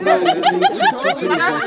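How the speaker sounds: very high, giggling repeated two; laughing correction. Real, not acted. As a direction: laugh while insisting. Correcting count while laughing.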